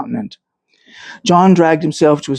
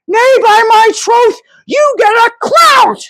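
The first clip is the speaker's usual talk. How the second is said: put-on much higher, louder, slow shrieking old woman; shrill shrew's threat; 4 dB louder, slower than usual. Noah's wife threatens Noah on stage, shrill shrieking shout.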